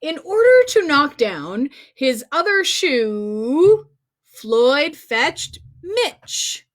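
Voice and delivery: plain reading voice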